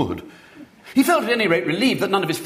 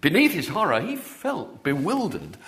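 plain reading voice

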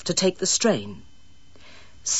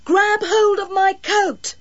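calling out; 13 semitones higher, 4 dB louder, slower than usual. A narrator's reading. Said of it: "she called".

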